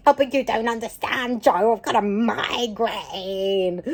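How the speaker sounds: complaining, drawn-out mock protest; whiny inner voice. Arguing with Joe in her own brain, whining. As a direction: whinge it out, dragging the last word. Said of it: "having this battle in my brain going".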